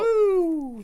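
high, loud whoop of awe; impressed whoop. Real, not acted. Co-host cheering after hearing a weighty quote, high whoop. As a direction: cheer the quote like it hit hard.

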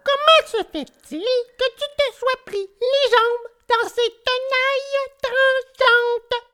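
very high, thin little insect voice; tiny firefly. Acted. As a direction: question in a tiny squeaky voice. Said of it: "répondit le ver luisant".

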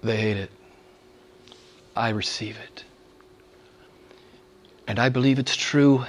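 usual speaking voice

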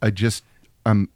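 low, soft, halting deadpan; mock-despairing, quiet low mumble with pauses; Neil Breen. Late-night caller confiding to Jim, mock-bleak impersonation. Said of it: "that's a pretty good impersonation of Breen".

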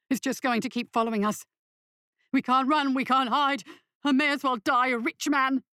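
panicky, half-mad outburst, pitch climbing after the start; manic with fear. A narrator's reading. Weber to Lackman as he leaps across shadows, manic with fear. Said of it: "Weber's voice was manic with fear".

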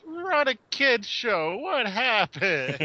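hammed-up appalled reaction; mock dismay. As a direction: wail it slowly in exaggerated disbelief. Acting out his guilty reaction.

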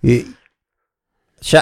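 normal talking voice

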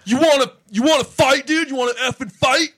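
high, loud mock challenge; heckler picking a fight. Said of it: "he goes".

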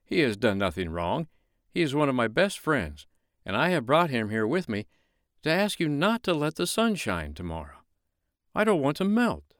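high pleading snowman voice; a snowman begging not to melt. Acted.

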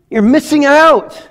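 preacher's rising declaration; emphatic call. Real, not acted. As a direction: ring it out to the room. Preacher bursts out after whisper.

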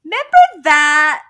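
high, loud, sing-song sarcasm; mock cheerful. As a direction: gush over it with fake nostalgia. Mocking future fond memories.

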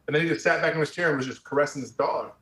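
ordinary talk, nothing marked

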